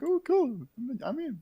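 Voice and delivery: ordinary delivery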